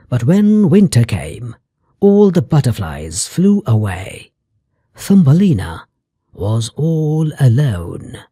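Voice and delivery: plain reading voice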